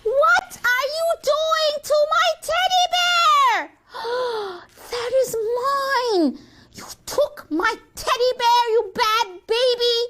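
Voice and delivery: very high, drawn-out outrage; outraged wail. Acted. Sister catching baby with her teddy bear, outraged. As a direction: wail slowly at the top of the voice.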